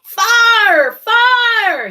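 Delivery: high, loud alarm call; crying out. Acted. Jack yelling fire at the birds. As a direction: yell it like a false alarm. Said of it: "he cried".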